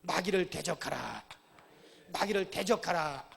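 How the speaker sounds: slow, loud, each word weighted; slow call to repeat. Shown as a demonstration. Pastor leading church to repeat, slow loud call. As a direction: lead the congregation in a solemn chant. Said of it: "따라합시다".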